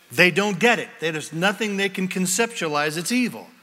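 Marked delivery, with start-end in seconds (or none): none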